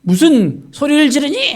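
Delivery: loud, high-pitched rebuke; scolding. Retold or imitated. Bystanders silencing the blind man, scolding. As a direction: tell someone off, pitch leaping up. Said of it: "막 꾸짖었습니다".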